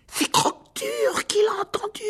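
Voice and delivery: slow, very high frightened wail. A narrator's reading. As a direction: wail it shrilly in panic. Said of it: "d'une voix plus aiguë qu'à l'ordinaire". (plainte suraiguë et affolée)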